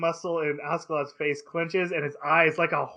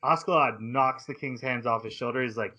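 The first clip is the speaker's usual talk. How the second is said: ordinary talk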